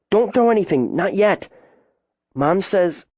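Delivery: put-on high, urgent child's plea; anxious urging. A narrator's reading. Frightened girl stops her friend.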